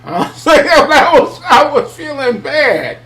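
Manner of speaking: high and slow, laughing through the words; giggling while confessing. Real, not acted. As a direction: laugh helplessly as you admit it.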